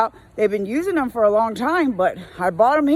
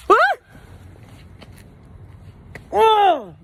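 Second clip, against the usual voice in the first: startled yelp; 11 semitones higher than usual. Real, not acted. Driver startled by a rat darting out, startled yelp. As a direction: jump at the rat.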